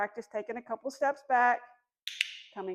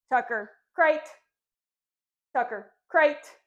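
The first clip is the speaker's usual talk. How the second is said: calling command; 2 semitones higher, 8 dB louder, slower than usual. Real, not acted. Commanding puppy into crate.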